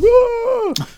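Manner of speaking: sudden high yelp of fright; hammed-up jump-scare scream; mock scream. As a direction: jolt out a startled high shriek. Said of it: "I was like".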